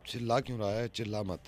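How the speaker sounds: hushed, urging him to stop shouting. Real, not acted. To the friend on speakerphone, hushed warning. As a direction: drop the voice, someone might hear. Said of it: "तू speaker phone पे है".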